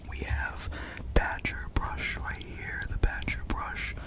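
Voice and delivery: usual speaking voice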